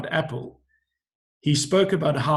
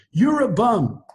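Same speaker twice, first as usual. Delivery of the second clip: heckler's jeer; 7 semitones higher, 4 dB louder, slower than usual. Retold or imitated. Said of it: "the guy looks at him and says".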